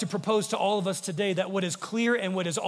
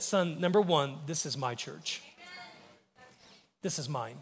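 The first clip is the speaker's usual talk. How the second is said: gentle voice of God; 3 semitones lower, 5 dB quieter than usual. Retold or imitated. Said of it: "He said".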